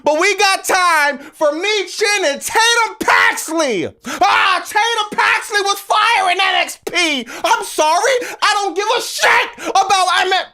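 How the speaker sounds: high, loud, furious shouting; yelling in exasperation, voice pushed high; angry rant. Real, not acted. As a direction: blow up at the company. Ranting about booking.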